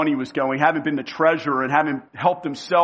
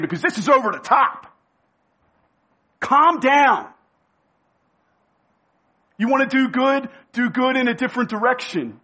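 scolding rebuke; 7 semitones higher than usual. Acted. Disciples scolding Mary.

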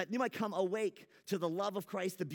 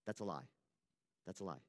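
low, quiet voice insisting that's a lie; a dark voice murmuring in his ear; the voice he heard in church, low and quiet; lying inner voice; 11 semitones lower, 8 dB quieter than usual. Acted. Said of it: "he would hear a voice saying".

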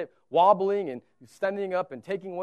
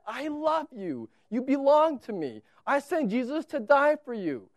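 loving Father urging; 8 semitones higher, 8 dB louder than usual. Pretend. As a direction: pour out fatherly love and belief. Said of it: "he's your Father saying".

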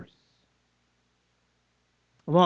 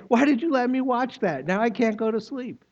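higher, lightly voiced child complaining after a scary show; scared child whining; 6 semitones higher than usual. Preacher imitating a frightened child who can't sleep, mildly whiny. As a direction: play the kid who can't sleep now, a touch whiny. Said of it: "what do they say?".

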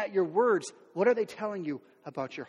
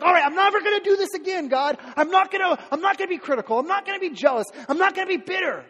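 higher, louder resolute vow; fervent vow; 9 semitones higher, 6 dB louder than usual. Retold or imitated. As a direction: swear it to God, all in. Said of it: "you're like".

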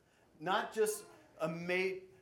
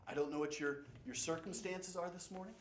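ordinary talk, nothing marked

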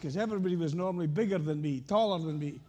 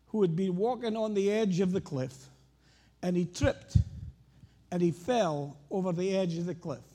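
ordinary delivery

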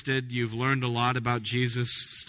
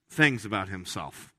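normal talking voice

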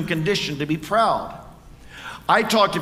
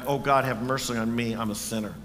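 humble plea for mercy; 7 semitones lower, 5 dB quieter than usual. Retold or imitated. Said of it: "beat his breast and cried out".

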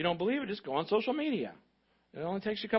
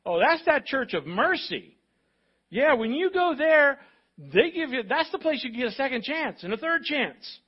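raised, loud, emphatic endorsement; enthusiastic townsperson; 7 semitones higher, 6 dB louder than usual. Acted. Imagined outsider telling others about the church of mercy, enthusiastic. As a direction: talk it up like an excited recommender. Voicing people praising the church.

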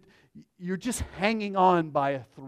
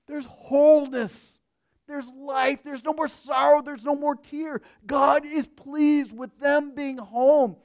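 higher, louder, rising preacher's cry; fervent proclamation; 7 semitones higher, 6 dB louder than usual. Acted. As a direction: proclaim heaven with swelling joy. Preaching climax.

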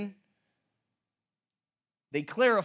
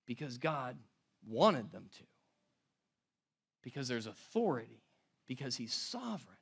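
hushed conviction; 8 semitones lower, 10 dB quieter than usual. Real, not acted. Solemn sermon conclusion.

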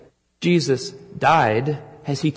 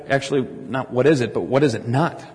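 ordinary delivery

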